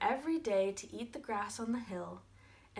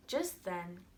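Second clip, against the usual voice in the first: ordinary delivery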